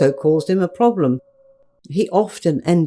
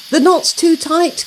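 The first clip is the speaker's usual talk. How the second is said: gasped alarm; 11 semitones higher, 3 dB louder than usual. Acted. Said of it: "gasped Queenie".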